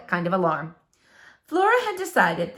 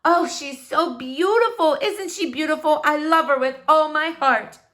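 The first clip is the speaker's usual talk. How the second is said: mother adoring her new lamp, gushing; gushing delight; 6 semitones higher, 3 dB louder than usual. Retold or imitated. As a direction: swoon over the lamp. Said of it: "clapped her hands and said".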